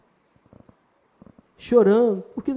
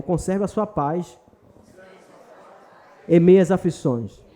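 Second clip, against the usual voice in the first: ordinary talk, nothing marked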